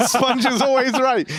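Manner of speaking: laughter breaking into the words; laughing while reading. Real, not acted. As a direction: read it out while cracking up. Reader cracks up at gushing email.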